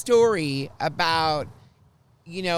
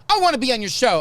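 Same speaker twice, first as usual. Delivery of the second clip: high, loud eager quote; thrilled fan; 6 semitones higher, 7 dB louder, faster than usual. Retold or imitated. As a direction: imitate his eager outburst. Passenger thrilled about the show.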